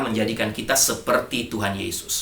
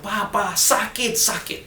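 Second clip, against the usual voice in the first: much higher, slow, wailing child's voice, retold; imitating a child crying out in pain, pitched high; 10 semitones higher, slower than usual. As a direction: stretch out the hurting girl's cry. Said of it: "dia teriak".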